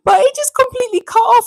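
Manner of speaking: high, loud exasperated outburst; exclamation. Real, not acted. Telling viewers the live cut off, exclaiming. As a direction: throw it out in disbelief. Exasperated about stream cutting.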